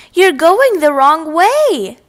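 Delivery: slow, spaced-out shout after him; teacher calling. A narrator's reading. Teacher calls after Froggy.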